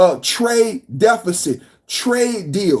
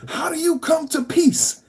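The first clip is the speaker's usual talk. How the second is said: ordinary talk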